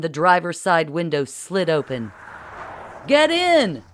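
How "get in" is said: high, loud shout over the noise. A narrator's reading. Don yells to Bill and Marcy from the car window, shouting. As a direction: yell it over the ruckus. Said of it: "Don shouted over the ruckus".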